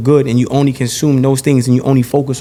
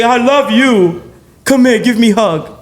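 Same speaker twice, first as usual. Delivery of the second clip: mock loving victim; 6 semitones higher than usual. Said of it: "turn around and say".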